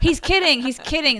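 he's kidding, said up and loud twice; mother correcting husband in front of kids, loud; retold protest.

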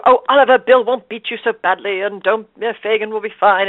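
put-on bright stagey Cockney accent. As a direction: play Nancy in Cockney. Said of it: "doing this beautiful Cockney accent".

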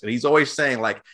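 ordinary talk, nothing marked

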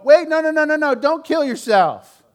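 a mock frantic cry of "don't kill yourself"; mock frantic plea. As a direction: fake alarm, pleading him not to do it. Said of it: "I certainly wouldn't go".

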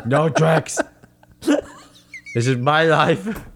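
lightly voiced dazed boxer, dragging it out; slow punch-drunk fighter's protest; Rocky impression. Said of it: "He was like".

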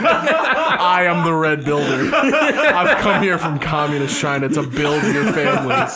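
hammed-up slow declaration; mock grand character. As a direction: announce yourself like a pompous hero, laughing. Riffs as made-up red builder character.

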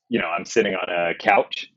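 office-worker American accent. Shown as a demonstration. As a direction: imitate an everyday American accent. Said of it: "can you do an American accent?".

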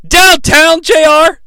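high, loud incredulous question; scoffing at the commentator; mock disbelief. Real, not acted. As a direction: call out the stolen line in disbelief. Mocking J R's stolen catchphrase.

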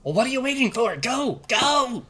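high cackling crone yelling go; shrill witch shout. Acted. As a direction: shoo them off like an impatient witch. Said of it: "the witch taps her staff on the ground and goes".

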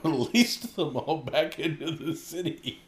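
slow down as laughter breaks in; laughing. Real, not acted. Co-host cracking up telling of pigeons in hats, laughing.

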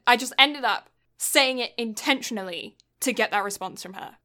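ordinary talk, nothing marked

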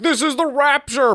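high, loud rapturous yell; preacher shouting. Retold or imitated. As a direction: proclaim the rapture in a loud, shrill cry. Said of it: "the preacher is like".

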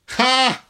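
loud, high bursting cackle; real laugh, blasted out high and long; gloating laugh. Real, not acted. Gloating over the captain's mishap, loud laugh.

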